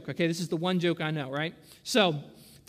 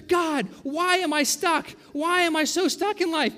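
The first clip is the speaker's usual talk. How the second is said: high-pitched, drawn-out plea to God; mock complaint; 8 semitones higher, 4 dB louder, slower than usual. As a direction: wail it upward like a hammed-up prayer. Acts out people complaining to God.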